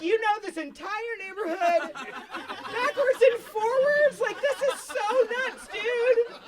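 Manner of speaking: high, loud, overexcited gushing; squealing praise, laughing through it; gushing excitement. Retold or imitated. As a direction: gush over her like she's a hero. Gushing praise to friend Abby.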